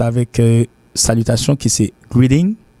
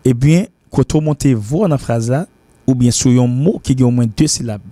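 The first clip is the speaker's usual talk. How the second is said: ordinary talk, nothing marked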